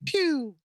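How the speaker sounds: high little pretend laser shot; mock gunshot. Isaac shooting a foam finger, high mock gunshot. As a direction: squeak out a toy-gun noise. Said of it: "he just goes".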